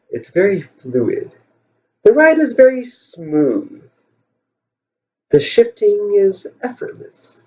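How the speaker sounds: slow, drawn-out mock sales pitch; mock salesman. Car salesman answering a Porsche buyer, slow mock pitch. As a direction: lightly imitate a salesman, lingering on the superlatives. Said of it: "He's a salesman".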